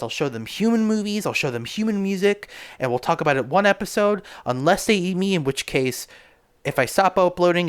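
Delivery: thrilled captain's log announcement; excited announcing. Acted. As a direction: hype up the space news like a showman.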